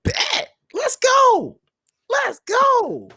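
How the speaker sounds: high-pitched fired-up chant; hyped cheering. Retold or imitated. Fan hyped for next week's match, cheering. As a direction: cheer it very high, stretching the last word.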